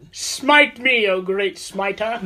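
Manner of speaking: slow, hammed-up psalm voice; mock grand pleading to God; mock biblical prophet. Mocking David's psalm.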